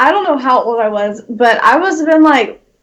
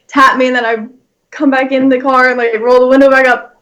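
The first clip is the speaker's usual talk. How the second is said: normal talking voice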